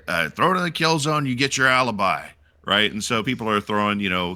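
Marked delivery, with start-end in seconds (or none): 0.3-2.3 a higher mock order from the sergeant; lightly voiced sergeant order; drill sergeant voice. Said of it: "they're like".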